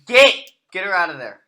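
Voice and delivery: sharp loud yell at the cat to get out; shouting at cat. Real, not acted. Yelling at his cat in the cleaning supplies, loud. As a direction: bark it at a cat in the bag.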